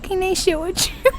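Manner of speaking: tiny high, soft squeak; hammed-up timid little voice; mock shy. Imitating shy self asking to join lunch, squeaky timid. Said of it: "like, scared".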